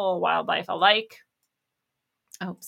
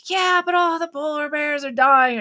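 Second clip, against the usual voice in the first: higher, louder mocking whine; mocking whiner; 7 semitones higher, 6 dB louder than usual. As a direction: parody the alarmist's protest. Mocking an opponent's quoted argument.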